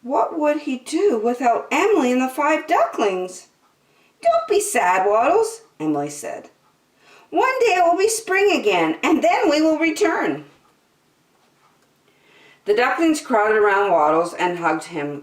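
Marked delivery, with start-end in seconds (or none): 4.2-5.6 high-pitched consoling mother duck; reassuring storybook duck, pitched up; Emily the duck. Acted. Emily comforts Waddles. 7.3-10.5 high-pitched reassuring mother duck; hopeful storybook duck, pitched well up; Emily the duck. Acted. Voices Emily promising return.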